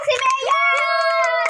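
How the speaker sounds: high, stretched-out cheer; high rallying cry; excited cheer. Acted. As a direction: whoop it out for the mayor. Cheering for the mayor.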